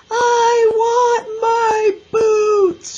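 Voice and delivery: very high, loud, drawn-out wail; howling. A narrator's reading. Little girl demands boots left in mud, howling. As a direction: howl slowly like a crying child. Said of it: "she howled".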